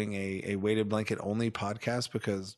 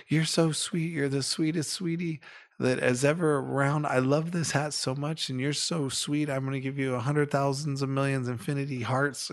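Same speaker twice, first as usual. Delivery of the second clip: higher-pitched, effusive sweet-talk; imitated gushing child; 4 semitones higher than usual. Boy to lady who gave her hat, imitated gushing. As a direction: mimic a kid showering someone with affection. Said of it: "typically what he would do, he was like".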